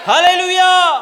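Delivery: high, loud rallying hallelujah; loud, enthusiastic shout. Shown as a demonstration. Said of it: "சத்தமா சொல்லுங்களேன்".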